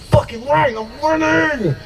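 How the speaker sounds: high, drawn-out victory cry; gloating triumph. Acted. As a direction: crow like you just won. Celebrates ex gaining weight.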